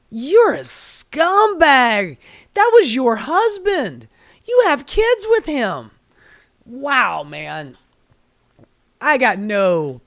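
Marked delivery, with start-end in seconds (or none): 0.1-5.9 high, loud, slow contempt; disgusted outburst. Real, not acted. Ranting straight at the widow, high and loud with disgust. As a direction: spit out each word in disgust.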